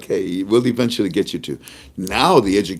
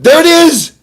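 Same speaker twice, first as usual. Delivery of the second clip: high, loud triumphant shout; excited shout; 11 dB louder than usual. Retold or imitated. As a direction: yell it like you just caught it. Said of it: "we both went".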